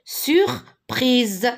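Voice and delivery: "Surprise" split into sur, prise, firmly voiced; syllable by syllable. Shown as a demonstration. Teacher sounding out a word for pupils, syllable by syllable.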